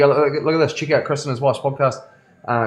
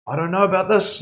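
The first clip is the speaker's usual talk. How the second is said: imitating a huffy barista, much higher; high, mock-sulky doubt with hands on hips; sassy disapproval; 9 semitones higher than usual. Jealous barista hearing about the coffee machine, high huffy mock sass. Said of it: "put her hands on her hips".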